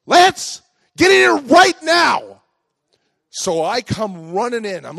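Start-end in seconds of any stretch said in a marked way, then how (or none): 0.1-2.4 very high, loud, drawn-out yell; angrier grandma shout. Retold or imitated. Grandma catches Lance in the ditch twice, furious mock shout. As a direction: bellow each word as a furious grandma, hammed up. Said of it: "now I'm gonna really get it".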